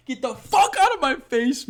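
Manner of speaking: high, loud mock-furious outburst; hammed-up angry yell; mock outrage. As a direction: blow up in exaggerated disbelief. Mock outrage at the fight card.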